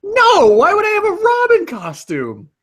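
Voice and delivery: high, loud outraged cry. Real, not acted. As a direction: refuse it, scandalized. Outraged refusal of the costume.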